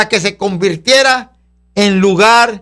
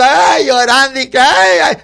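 quejidos agudos de imitación; lloriqueo fingido; 3 semitones higher than usual. Pretend. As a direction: imita a quien se lamenta entre ayes. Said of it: "No estés gritando". (gritos y llanto fingidos, moderados)

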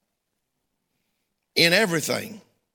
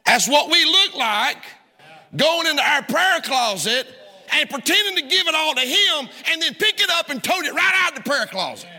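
fiery preaching; 8 semitones higher than usual. Real, not acted. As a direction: drive the point home at full force. Preaching climax.

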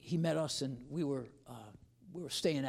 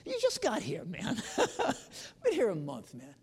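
exasperated thought; 10 semitones higher, 6 dB louder than usual. Retold or imitated. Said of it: "and I'm thinking".

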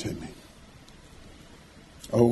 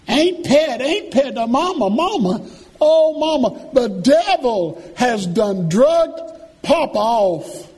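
mock panicked shout; 12 semitones higher than usual. Kids run in shouting alarm.

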